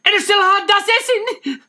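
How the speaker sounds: high, loud outraged cry; shocked shout. Acted. Missus Frank catching him with the food, shocked shout.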